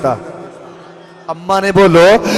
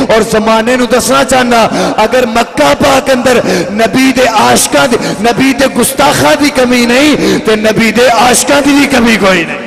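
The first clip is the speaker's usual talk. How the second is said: preaching; 5 semitones higher than usual. Acted. Rising sermon proclamation.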